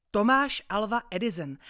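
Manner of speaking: high, loud proud answer; eager reply. Acted. Boy proudly telling the gentleman his name, loud. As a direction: announce your name proudly to the man. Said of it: "odvětil jsem".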